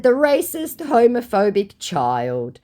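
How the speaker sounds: high, slow, scornful; snooty high voice. Retold or imitated. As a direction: spit the label slowly. Her own retort, acted.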